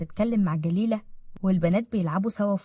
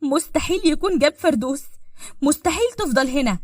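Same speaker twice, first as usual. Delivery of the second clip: higher, agitated refusal to believe; frantic denial; 6 semitones higher, 4 dB louder than usual. Acted. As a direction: refuse it in rising panic. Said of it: "بجنون".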